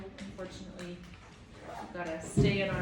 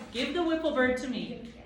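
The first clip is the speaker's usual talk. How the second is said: sharp, louder, higher demand; snapped order; 6 semitones higher, 6 dB louder than usual. A narrator's reading. The Proc snapping at Tom, loud and curt. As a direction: bark the order at the boy. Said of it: "the Proc snapped".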